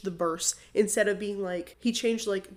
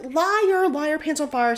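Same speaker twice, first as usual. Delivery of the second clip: mock yell; 6 semitones higher, 5 dB louder, slower than usual. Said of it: "Matthew was like".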